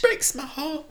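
very high mock lament; hammed-up heartbreak. Mother giving up on her boy, comic high keening. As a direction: whimper it in an exaggerated high voice.

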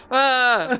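laughing startled cry; startled yelp. Real, not acted. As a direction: jump at the scare with a laugh. Startled by spooky guy face.

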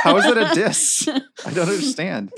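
high, squeaky-pitched bewilderment through laughter; laughing protest. Real, not acted. Teased player asking how that is a diss, laughing. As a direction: protest helplessly while cracking up.

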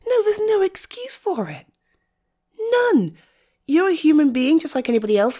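sharp, high-pitched "None"; high indignant refusal. Real, not acted. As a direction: cut off any excuse with a high, hard "None". Indignant defence of a friend.

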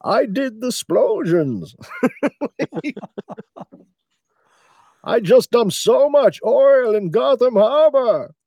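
high, loud, drawn-out cartoon villain; slow, booming comic villain voice pitched high; Bane impression. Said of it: "their Bane is definitely [name]'s Bane".

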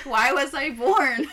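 lightly voiced baby in existential crisis, loud and drawn out; hammed-up infant despair; mock despairing baby. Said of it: "they're just like".

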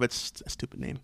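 hushed throwaway under the breath; muttered aside. Real, not acted. Aside about the stadium name, a hushed dismissive mutter. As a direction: drop it to a near whisper, dismissive.

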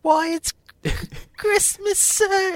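squeaky little urchin reply; Victorian street boy. Acted.

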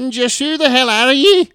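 shrieking high, loud falsetto granny voice; Mrs Doubtfire. Acted. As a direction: screech it out like a huffy old nanny. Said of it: "channel the ghost of Missus Doubtfire".

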